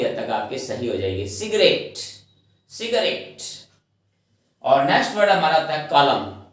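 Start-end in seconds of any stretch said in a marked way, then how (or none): 1.3-3.4 सिगरेट said slowly and clearly, si-ga-ret; careful correct pronunciation. Shown as a demonstration. Teacher to students, modelling सिगरेट clearly. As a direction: model the word सिगरेट syllable by syllable. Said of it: "इसकी pronunciation अगर हम सही करेंगे".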